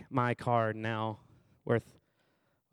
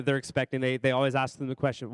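ordinary talk

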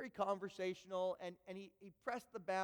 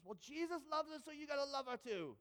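put-on higher British accent, lively; lightly put-on British accent, higher pitch; cheerful British accent, higher; 7 semitones higher than usual. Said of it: "his great British accent".

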